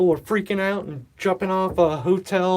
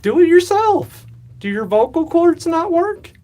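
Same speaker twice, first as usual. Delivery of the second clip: mock indignant; 10 semitones higher, 7 dB louder than usual. Real, not acted. Teasing viewer asking shout out.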